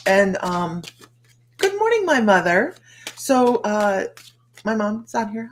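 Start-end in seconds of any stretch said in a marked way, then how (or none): 1.6-2.7 much higher and louder, sing-song; cheery greeting. Real, not acted. Greeting her mother who joined the live.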